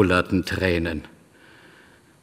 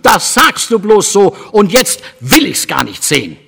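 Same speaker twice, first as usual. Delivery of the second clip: put-on higher, loud angry child; bitter outburst; 9 semitones higher, 5 dB louder than usual. A narrator's reading. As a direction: refuse it in sulky fury. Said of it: "in leidenschaftlicher Erbitterung".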